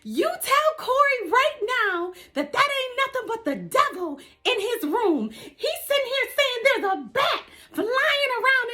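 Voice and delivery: high-pitched hysterical mother voice; voicing mom. Wife ordering husband to tell grandson it's the devil, high panicked voice. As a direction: shriek it like a panicked churchgoing mom. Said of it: "So my mom says".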